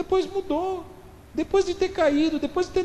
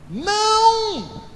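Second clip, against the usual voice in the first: high, sustained astonished Não; admiring surprised no; 9 semitones higher, 3 dB louder than usual. Shown as a demonstration. Jesus answering the servants with a high, long astonished Não. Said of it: "tem essa entonação aí ... De admiração, de surpresa".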